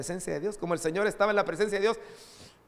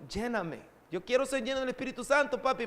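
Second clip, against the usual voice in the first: put-on child asking; 8 semitones higher than usual. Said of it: "de Valentina que le pidió al Espíritu Santo".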